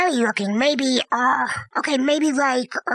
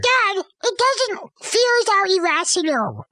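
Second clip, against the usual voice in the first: exasperated protest; 7 semitones higher than usual. Real, not acted. Child explaining fear to a doubting Dad, exasperated. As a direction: push back, stretching the words high. Child objects to dad's question.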